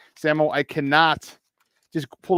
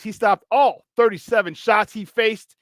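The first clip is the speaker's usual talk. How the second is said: ordinary talk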